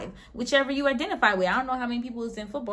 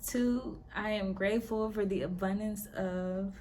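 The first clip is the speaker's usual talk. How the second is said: hypnosis countdown; 7 dB quieter than usual. Acted. Guiding the group into a hypnotic trance, softening. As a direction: lull them under, quieter each count.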